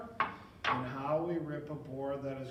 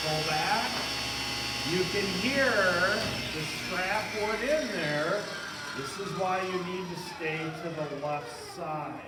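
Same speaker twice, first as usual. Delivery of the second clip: loud, raised voice over machine noise; projecting over a running saw; shouting over table saw; 8 dB louder than usual. Real, not acted. Turn the saw on.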